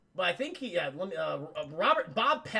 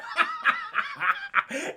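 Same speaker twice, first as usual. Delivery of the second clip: a very high, teasing cackle; mock high laugh; 3 dB louder than usual. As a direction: cackle at the kids' panic. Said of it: "He's like".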